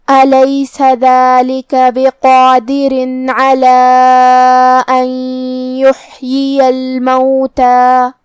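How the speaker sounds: slow, drawn-out Quranic chanting; recitation with tajweed. Shown as a demonstration. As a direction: chant the whole passage, vowels held long. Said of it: "سورۃ القیامہ کی تلاوت تجوید کے ساتھ سماعت فرمائیں". (تجوید کے ساتھ سورۃ القیامہ کی تلاوت)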